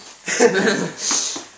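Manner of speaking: short high laugh. Real, not acted. Host laughs after naming James, amused laugh.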